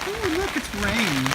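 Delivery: high, slow cutesy cat voice; cat's voice. Pretend. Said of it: "She's like".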